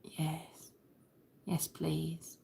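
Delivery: very soft, half-whispered yes; smitten murmur. Retold or imitated. Woman answering the crush whose accent she loves, smitten whisper. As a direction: breathe it out softly, helplessly smitten. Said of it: "start talking like this when I'm around him".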